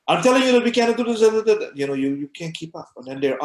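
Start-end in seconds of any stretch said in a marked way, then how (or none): 0.1-1.7 high, loud and fast, words tumbling out; rushed fast talk. Shown as a demonstration. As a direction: rattle it off far too quickly. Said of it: "people speak very fast".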